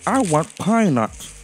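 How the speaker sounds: high, bright little squirrel voice, wanting; a fussy squirrel turning up his nose; squirrel Teekensee. A narrator's reading.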